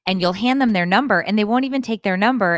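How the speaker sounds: imitated exasperated rant. Daughter venting about customers to mother, exasperated.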